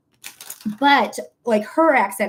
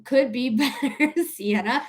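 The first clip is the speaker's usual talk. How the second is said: ordinary delivery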